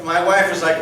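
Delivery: ordinary delivery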